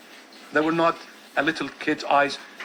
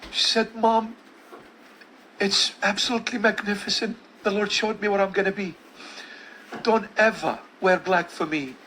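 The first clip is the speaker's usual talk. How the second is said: dying daughter's voice; 4 semitones higher than usual. Retold or imitated.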